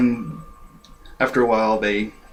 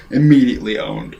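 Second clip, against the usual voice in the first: ordinary delivery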